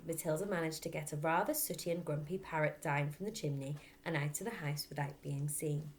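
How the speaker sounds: ordinary delivery, nothing marked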